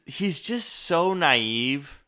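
drawn-out slow words; slow emphasis. Real, not acted. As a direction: stretch it out. Slowed emphasis.

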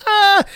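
very high short mock scream. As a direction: yelp in fright. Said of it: "scream in unison".

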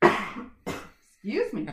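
cough, then a slow excuse me. Real, not acted. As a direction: clear the cough and apologize. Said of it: "You got me coughing today".

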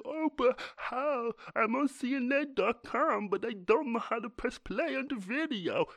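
high, loud, dragged-out mocking whine; slow, silly high voice of total confusion; clueless user. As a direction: play a hammed-up helpless idiot. Mocking helpless website visitor.

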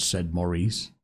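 plain reading voice

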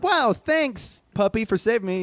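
put-on high, gushing little kid voice; grateful kid. Kid thanks puppy for saving him, grateful kid. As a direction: thank it like an earnest child.

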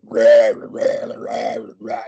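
lightly imitated death metal roar, loud on the first rah; mock the singer's stage growl. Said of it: "start singing".

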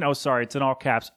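ordinary talk, nothing marked

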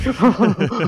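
put-on high, giddy kid's cackle; mock maniacal child laugh; overexcited maniacal laugh. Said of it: "overexcited laugh".

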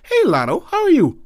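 suspiciously sweet sibling hello; sister's sweet voice. Retold or imitated. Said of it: "I get to the back".

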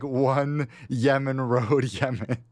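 slow, deliberate made-up address; stalling, measured sitcom delivery; Chandler. Acted. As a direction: spell it out slowly, word by word. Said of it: "And then he goes like".

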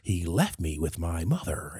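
slow, whispered sob-story confession; mock whisper. Host telling a mock childhood sob story, slow stage whisper. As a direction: whisper it slowly, hamming it up.

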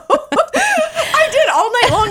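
high, slow words through laughter; laughing. Real, not acted. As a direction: giggle the whole line out.

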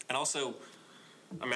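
ordinary talk, nothing marked

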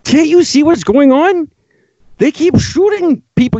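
exasperated shouting. Real, not acted. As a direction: shout at them in disbelief. Ranting at gun supporters.

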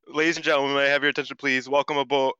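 imitating ring announcer. Co-host mimics Waka reading the English intro, hammed-up announcer. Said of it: "So she's like".

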